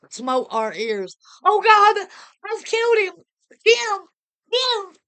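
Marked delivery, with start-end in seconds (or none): 1.4-5.0 high screaming wail of horror; anguished scream. A narrator's reading. Wife screaming over the husband she shot, high anguished wail. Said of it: "her wild scream of agony".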